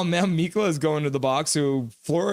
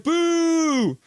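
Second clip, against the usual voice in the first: much higher shouted boo; mock shouted boo; 13 semitones higher, 3 dB louder than usual. Streamer voicing Milosh's boo as a much higher shout. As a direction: yell the boo up high for laughs. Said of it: "then Milosh is like".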